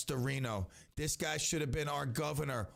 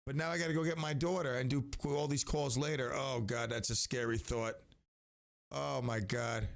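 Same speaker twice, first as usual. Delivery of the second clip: ordinary talk, nothing marked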